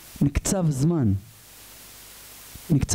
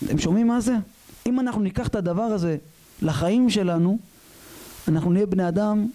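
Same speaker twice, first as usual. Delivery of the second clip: normal talking voice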